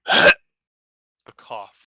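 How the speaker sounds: one loud hacking cough, then name it; a single loud, aged cough; old clown's cough. Acted.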